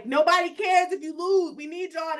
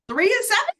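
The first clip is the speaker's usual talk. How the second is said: high, loud rising question; shocked rising three and seven; outraged disbelief; 9 semitones higher, 6 dB louder than usual. Acted. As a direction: ask it like you can't believe it. Repeats record in disbelief.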